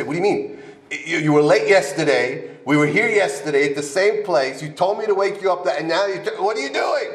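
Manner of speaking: high-pitched, rising exasperation; indignant protest. Acted. Madrich argues back at the guy he woke, indignant. Acts out arguing with the sleeper.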